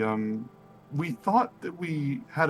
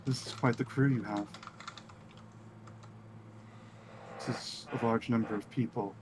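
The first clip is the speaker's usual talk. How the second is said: elven woman; 3 semitones lower, 13 dB quieter than usual. Acted. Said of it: "this elven woman, uh, looks at you all and says".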